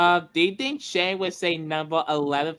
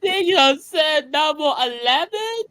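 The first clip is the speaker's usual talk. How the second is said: shocked; 10 semitones higher, 3 dB louder than usual. Real, not acted. Shocked at swear.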